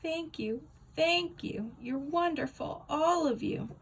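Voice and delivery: high, gushing prom-queen thanks; smug queen thanking her fans. Acted. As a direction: wave and gush like a prom queen. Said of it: "like a stinking prom queen".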